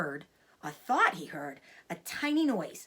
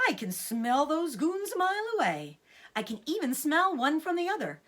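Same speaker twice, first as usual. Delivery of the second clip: higher, louder boastful fox; cocky Mister Fox; 9 semitones higher, 5 dB louder than usual. Acted. Mister Fox boasts to his wife about the farmers, cocky. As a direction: brag about his nose.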